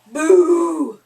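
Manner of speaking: sudden loud boo. A narrator's reading. Friend scaring the cat, sudden loud boo. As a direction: jump out and shout boo. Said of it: "cried Spike".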